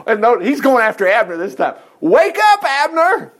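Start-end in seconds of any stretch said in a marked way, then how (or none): 2.0-3.3 high, raised wake-up call. Acted. David wakes Abner from across the camp, calling out high. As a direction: holler it to rouse a sleeper.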